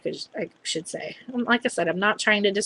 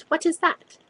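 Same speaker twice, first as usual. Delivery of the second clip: lightly mimicked higher-pitched question; a hint of the puzzled technician, higher; imitated lab technician; 8 semitones higher than usual. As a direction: imitate her not understanding. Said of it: "there was definitely a language barrier".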